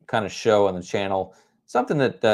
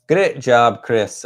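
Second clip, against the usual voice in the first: louder, playful mock Scottish accent; 2 semitones higher, 7 dB louder, much slower than usual. Host cheering a viewer named Chris, in a playful Scottish accent. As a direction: praise him with a light put-on Scottish accent. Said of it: "I always have to talk about Chris in a Scottish accent".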